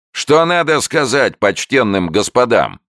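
high, loud stern command; stern showman. A narrator's reading. Showman sternly prompting his newt, high loud stern voice. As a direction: demand it like a strict trainer. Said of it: "строго спросил человечек".

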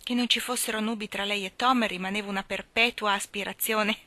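ordinary delivery

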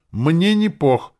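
high, loud, drawn-out retort; demon's declaration. A narrator's reading. Demon protests before a nervous laughing fit, high and loud. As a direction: announce it loudly, stretching the words. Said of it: "заявила она и зашлась нервным демоническим хохотом".

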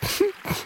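soft breathy giggle; a quick whispered giggle. A narrator's reading. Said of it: "Mathilda kichert".

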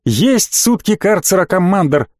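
usual speaking voice

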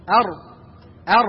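أر with the ra trilled; rolled ra. Shown as a demonstration. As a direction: roll the ra to show the repetition. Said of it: "يحصل ارتعاء". (أر مع تكرير الراء)

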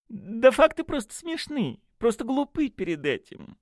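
high-pitched gushing enthusiasm; rapturous. A narrator's reading. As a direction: exclaim in delight, voice way up. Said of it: "восторженно вскричал он". (восторженно, очень высоким голосом)

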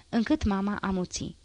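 usual speaking voice

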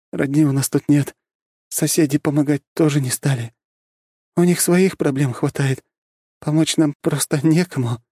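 high, tired voice of a grieving widow; weak young mother. Acted. Widowed mother tells her story.

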